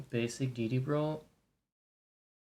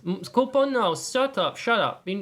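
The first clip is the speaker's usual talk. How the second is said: high, loud sharp scolding; 8 semitones higher, 7 dB louder than usual. Real, not acted. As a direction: bark a quick shut up. Telling the pet to shut up.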